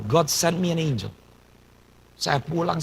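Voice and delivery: normal talking voice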